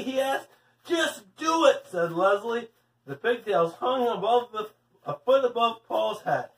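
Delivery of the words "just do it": high, drawn-out command; insistent. A narrator's reading. Leslie tells Paul to grab on, high and insistent. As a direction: urge it impatiently, stretching the words. Girl orders boy to grab pigtail.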